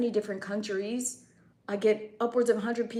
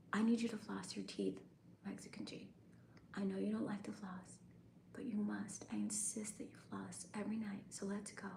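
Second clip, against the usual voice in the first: soft, breathy ASMR voice; hushed nighttime whisper; 2 semitones lower, 16 dB quieter than usual. Acted. ASMR bedtime routine again.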